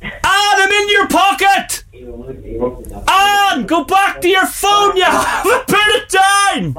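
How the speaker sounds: very high, straining yell; calling out. Real, not acted. Hosts call to caller who left line open, high shouting. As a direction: yell to someone far from the phone.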